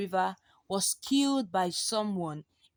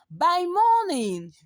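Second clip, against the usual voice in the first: ordinary delivery, nothing marked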